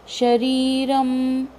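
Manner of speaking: very slow शरीरम, syllables drawn out; slow pronunciation. Shown as a demonstration. Teacher showing how to say शरीरम, very slowly. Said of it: "र के ऊपर अनुनासिक".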